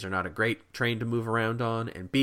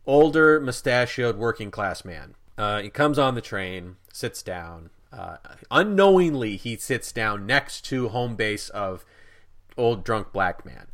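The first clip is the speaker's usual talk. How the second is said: ordinary delivery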